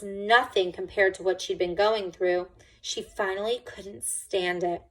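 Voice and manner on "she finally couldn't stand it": plain reading voice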